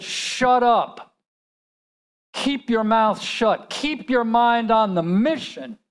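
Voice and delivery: imitated soldier's urgent rebuke; acted stern order. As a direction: order a panicking comrade back into line. Soldier ordering panicking comrade to focus.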